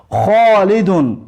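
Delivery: خالدون with a rasping throat kh, slow; careful Arabic kh. Shown as a demonstration. Teacher drilling خالدون for Tajik students, slow throat kh. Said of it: "استمع و اعید".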